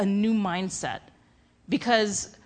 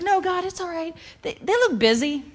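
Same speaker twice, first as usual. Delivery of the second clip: high, whiny excuse to God; a nervous dodge, pitched up; pleading excuse; 9 semitones higher than usual. Retold or imitated. Young believer dodging God's prompt, in a high whine.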